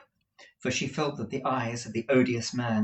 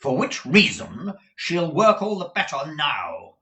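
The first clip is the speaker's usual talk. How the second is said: loud, raised, emphatic interjection; pushy uncle cutting in; forceful Ralph Nickleby; 7 semitones higher, 9 dB louder than usual. Acted. Ralph puts in a word for Kate, loud and forceful.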